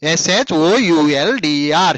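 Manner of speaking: each letter spaced and held; slow spelling out. Shown as a demonstration. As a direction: dictate the letters slowly. Teacher repeats spelling slowly.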